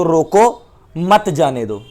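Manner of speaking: "रोको" set apart, then "मत जाने दो" together; pause after रोको. Shown as a demonstration. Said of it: "रोको के बाद कोमा लगा दिया".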